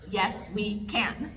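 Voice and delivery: usual speaking voice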